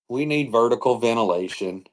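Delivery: ordinary delivery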